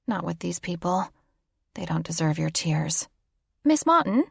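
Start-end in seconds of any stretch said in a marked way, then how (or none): 3.6-4.2 high, crisp British flight attendant calling a name; prim British accent. Acted. Flight attendant addressing the passenger in a prim British accent. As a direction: clip it out like a polite English stewardess. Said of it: "in her prim British accent".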